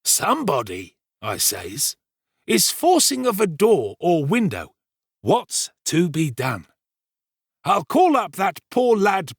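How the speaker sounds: self-important storyteller Giles. Acted. Servant retelling the night's alarm to the kitchen, pompous storytelling.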